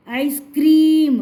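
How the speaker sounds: आइसक्रीम said slowly, with क्रीम stretched on a long ee; slow sound demonstration. Shown as a demonstration. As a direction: draw out the ee in आइसक्रीम. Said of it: "देखो sound सुन रहे हो". (आइसक्रीम धीरे, क्री की ई खींचकर)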